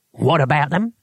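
short, testy toothless retort; touchy old man biting back; snapped. A narrator's reading. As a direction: snap it through bare gums. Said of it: "snapped Cohen".

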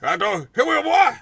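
put-on loud, high-pitched old bluesman act; old blues man. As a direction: wail it like a hammed-up blues elder. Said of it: "I talk like an old blues man".

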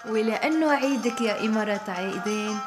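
ordinary talk

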